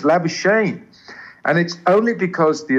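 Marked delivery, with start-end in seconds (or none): 0.0-0.8 Labuschagne said LAB-uh-shayn; anglicised pronunciation. Retold or imitated. Quoting people who ripped his colleague, anglicised Labuschagne. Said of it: "They, they say Labuschagne".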